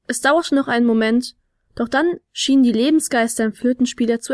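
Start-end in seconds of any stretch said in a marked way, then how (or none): none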